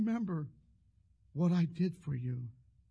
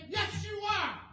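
raised retort; 13 semitones higher, slower than usual. Retold or imitated. Preacher insists back at Roy.

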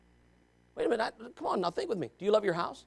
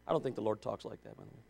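dropped low and soft, quick; quiet aside; 13 semitones lower, 18 dB quieter, faster than usual. Real, not acted. Preacher adding a wry aside to the congregation. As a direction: toss it off under your breath.

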